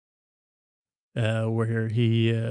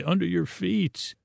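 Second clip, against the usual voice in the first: lightly voiced kids, higher pitch; retold kids' voice; 6 semitones higher, 4 dB quieter than usual. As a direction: pipe up softly like the kids. Retold kids pointing out the chicken.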